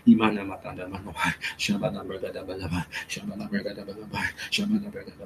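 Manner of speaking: fading, quickening tongues; speaking in tongues. Acted. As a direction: let tongues trail off softly.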